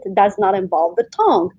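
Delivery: ordinary delivery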